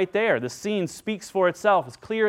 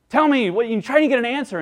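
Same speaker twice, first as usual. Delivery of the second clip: higher and louder, mock exasperated demand; imitating someone stonewalled in an argument, raised voice; frustrated demand; 6 semitones higher, 5 dB louder than usual. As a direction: push for an answer that never comes. Frustrated arguer in conversation.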